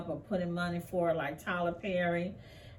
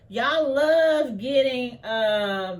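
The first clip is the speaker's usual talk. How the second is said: much louder, slow, mocking jab; 3 semitones higher, 8 dB louder, much slower than usual. Real, not acted. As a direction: call them out loud and slow. Heated jab at police.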